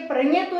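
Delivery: परंतु mispronounced with "ny", as "प्रण्यतु"; wrong nasal sound. Shown as a demonstration. Teacher repeating what we don't say, परंतु with a "ny" sound. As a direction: say "pran-ya-tu" for परंतु. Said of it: "प्रण्यतु नहीं बोलते".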